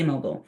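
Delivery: "mobile" with a reduced "bull" ending; short-ending mobile. Shown as a demonstration. Trainer telling the class their own choice, "mobile" said MOH-bull. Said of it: "I say mobile".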